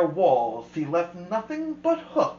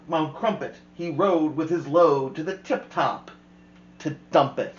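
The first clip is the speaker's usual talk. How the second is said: ordinary delivery, nothing marked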